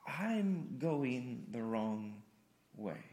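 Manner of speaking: soft, very slow realization; quiet confession. Acted. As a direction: let it dawn slowly and softly. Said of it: "and you go".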